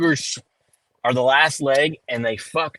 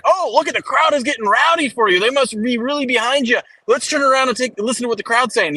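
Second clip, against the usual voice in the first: higher, sugary TV-reporter voice; imitating the interviewer lady; 9 semitones higher than usual. Mocking the NASCAR interviewer for his buddy, higher. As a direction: put on a chirpy interviewer's voice to mock her. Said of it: "and she's like".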